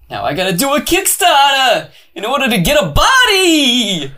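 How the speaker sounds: goofy high shouted whine; Legs voice. Acted. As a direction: squeal it as a silly giant. Voicing Legs, joking.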